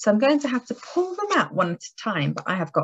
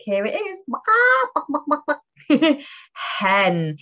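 ordinary talk

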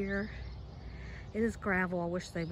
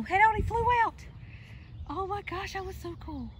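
high-pitched delighted surprise; excited; 12 semitones higher than usual. Real, not acted. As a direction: squeal with amazement at a sudden sight. Bird suddenly flies out.

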